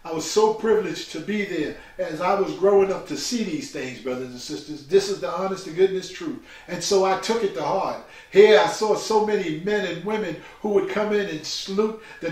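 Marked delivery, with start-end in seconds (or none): none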